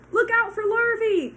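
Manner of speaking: very loud, high warning call; cows calling a warning. Acted. Cows warning Wilbur about Lurvy, loud. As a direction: bellow the warning. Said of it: "called the cows".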